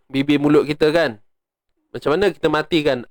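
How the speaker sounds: ordinary talk, nothing marked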